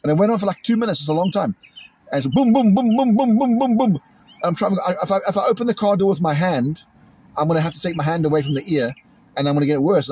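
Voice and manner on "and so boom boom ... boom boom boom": chanted booms, pitched higher; mock firework barrage. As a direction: rattle off booms like exploding fireworks. Imitating fireworks going off.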